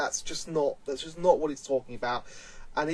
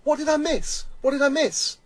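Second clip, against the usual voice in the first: put-on much higher, puzzled child voice; confused kid; 10 semitones higher, 4 dB louder than usual. Ten-year-old asking the grown-ups, high puzzled voice. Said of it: "turns to us and goes".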